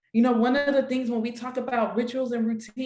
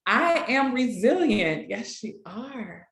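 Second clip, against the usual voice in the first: slow, firm first-person affirmations; affirmation read; slower than usual. Shown as a demonstration. Facilitator reading the group's affirmations, slow and firm. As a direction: say each line as a promise to yourself. Reads participants' affirmations aloud.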